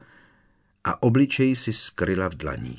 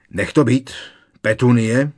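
loud, higher roared warning; 5 semitones higher, 8 dB louder than usual. A narrator's reading. Strýc Vernon warns Petunie off the letter, roaring. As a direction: roar at her to stop, raised pitch and volume. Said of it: "zařval strýc Vernon".

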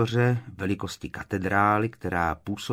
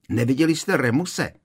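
raised, higher-pitched question; calling after someone; 5 semitones higher, 4 dB louder than usual. Acted. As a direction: call out an urgent question. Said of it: "křikla za ním Tonksová".

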